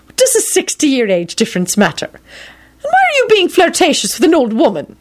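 put-on high, playful elderly lady; teasing old woman. A narrator's reading. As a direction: tease him. McGonagall teasing.

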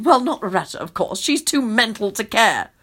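usual speaking voice

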